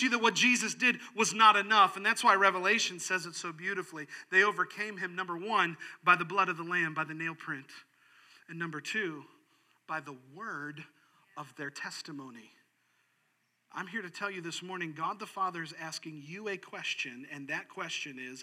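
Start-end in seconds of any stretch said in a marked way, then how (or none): none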